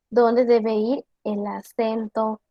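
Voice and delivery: normal talking voice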